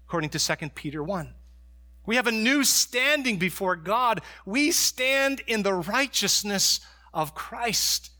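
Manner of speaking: high and loud, with punched key words; full-force preaching. Acted. Pastor proclaiming what believers have in Christ, at full force.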